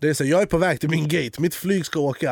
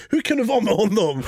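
retold anger; 6 semitones higher than usual. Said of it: "jag var så jävla arg".

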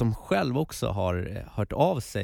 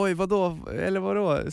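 mock bewildered; 9 semitones higher, 3 dB louder than usual. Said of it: "jag bara".

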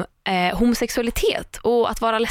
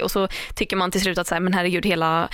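ordinary talk, nothing marked

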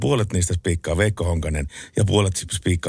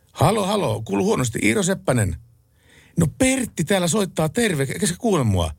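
calling into a bad line, much higher; high mock phone shouting; 9 semitones higher than usual. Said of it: "retold voice on bad line". (korkea huuto puhelimeen)

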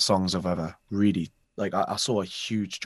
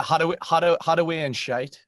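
higher, emphatic Geordie model; Geordie accent; 5 semitones higher, 3 dB louder than usual. Shown as a demonstration. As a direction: teach a broad Geordie phrase. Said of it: "try this one".

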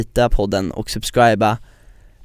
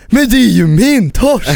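high, louder, slow outraged protest; indignant Albin; 9 semitones higher, 4 dB louder, slower than usual. Retold or imitated. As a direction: insist it is your cod. (upprörd hög protest)

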